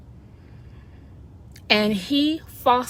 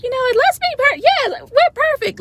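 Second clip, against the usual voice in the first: much higher and louder, a whooping party voice; partying cells; 8 dB louder than usual. Retold or imitated. Cells partying together, high and loud celebration. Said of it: "They have a party and they're like".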